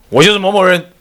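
boastful. Acted. Nagarjuna boasts his name.

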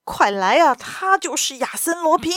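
high, urgent call for help; shouting out. A narrator's reading. Detective exposes the thief to the servants, shouting out. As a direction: raise the alarm in a high voice. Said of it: "高声喊道".

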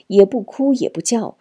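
ordinary delivery, nothing marked

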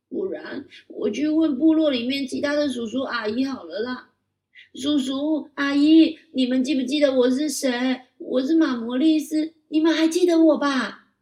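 put-on high, loud child voice calling the villagers. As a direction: call to aunts and uncles. (高声童音，大声呼唤)

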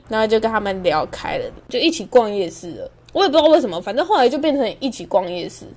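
normal talking voice

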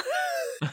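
short high burst of laughter. Real, not acted. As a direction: laugh out at the memory. Laughs at own story. (高声大笑)

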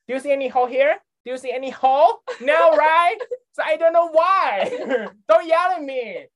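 scolding the plumber in English; mock scolding. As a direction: tell him off with big rising questions. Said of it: "一直狂骂那一个人".

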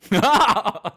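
high, loud burst of laughter. Real, not acted. Laughing hard while telling a podcast story. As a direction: laugh out at the memory of the blunder.

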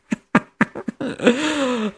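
gleeful cackle at the twist; high gleeful laugh. Real, not acted. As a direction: burst of high delighted laughter. Friend's revenge backfires on her.

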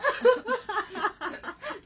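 high, loud laugh. Real, not acted. Laughing about her reply on the phone, high laugh. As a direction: let the laugh spill out.

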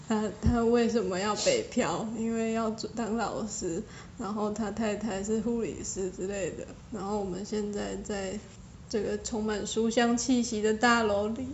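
speaking quietly. Real, not acted. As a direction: keep it low like in a library. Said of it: "你为什么讲那么小声".

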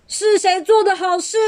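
high, furious demand; angry roar. A narrator's reading. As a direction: bellow it in anger. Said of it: "怒吼着".

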